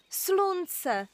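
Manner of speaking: high joyful cry of discovery; boy shouts on seeing the sun; exclaiming. Acted. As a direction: call out the word in delight. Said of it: "vykřikl".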